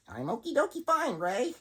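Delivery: high, sing-song puppet; chirpy and a bit too sweet; cheery puppet voice. Acted. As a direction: puppet brushes off Ray's worry, chirpy. Puppet answers in its voice.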